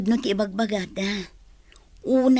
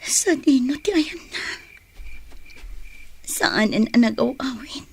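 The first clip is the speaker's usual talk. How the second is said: ordinary talk, nothing marked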